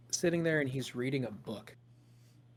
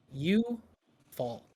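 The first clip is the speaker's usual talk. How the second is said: ordinary delivery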